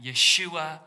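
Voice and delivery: ordinary delivery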